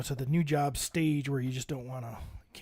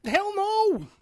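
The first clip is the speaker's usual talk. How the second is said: a sudden, high yelled denial; loud outburst; 5 dB louder than usual. Real, not acted. Asked if his mother paid, he yells back. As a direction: shoot the idea down hard.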